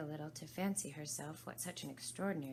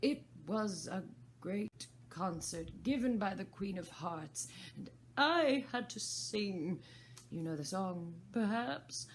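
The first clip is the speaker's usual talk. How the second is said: high, louder Hatter voice; 4 semitones higher, 5 dB louder, slower than usual. Acted. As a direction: tell it grandly as the Hatter.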